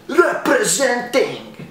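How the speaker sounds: drawn-out, high showy proclamation; hammed-up boast. Comic showing off his hometown Sarajevo, hammed-up boast. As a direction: sing out a mock hometown boast. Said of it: "No, seriously".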